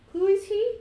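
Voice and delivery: put-on a curious small boy; little Strahd. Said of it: "little Strahd says".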